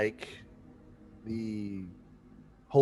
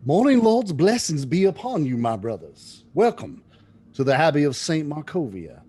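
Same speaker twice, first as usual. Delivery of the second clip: loud, higher, welcoming monk's greeting; warm booming abbot; 4 semitones higher, 7 dB louder than usual. Acted. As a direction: greet the guests like a beaming abbot.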